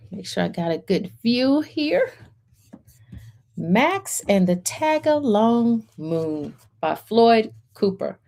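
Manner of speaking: usual speaking voice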